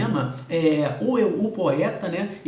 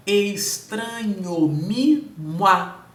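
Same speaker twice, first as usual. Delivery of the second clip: very slow, each syllable of "Estranho mimo a-" counted out separately; syllable-by-syllable scansion; 3 semitones higher, 4 dB louder, much slower than usual. Shown as a demonstration. Teacher counts metrical syllables for the class, slow and separated. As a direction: spell the meter out beat by beat, slowly. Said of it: "eu fiz a contagem pra vocês aqui das sílabas".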